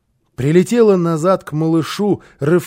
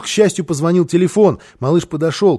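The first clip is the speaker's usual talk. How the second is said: ordinary delivery, nothing marked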